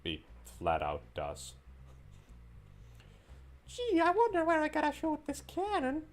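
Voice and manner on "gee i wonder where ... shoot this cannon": very high, loud silly voice; hammed-up sarcastic puzzlement; mock wondering. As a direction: feign cluelessness in a squeaky voice.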